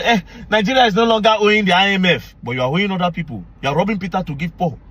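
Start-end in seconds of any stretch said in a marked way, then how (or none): 0.0-2.4 a put-on official's voice, higher and smug; mock official boast. Officials telling the president about the debt, in a mocking voice. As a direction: mimic a minister bragging about the debt. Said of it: "they'll come, they'll tell him".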